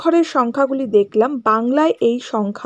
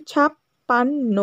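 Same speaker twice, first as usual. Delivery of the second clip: ছাপ্পান্ন spoken slowly, syllables apart; teacher slowing a word for pupils; much slower than usual. Shown as a demonstration. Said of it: "আস্তে করে বলো".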